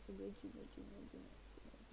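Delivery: very soft, half-whispered repetition; muttering to self. Real, not acted. Streamer hunting for the add-image option, muttering to self. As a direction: mumble it under your breath while busy.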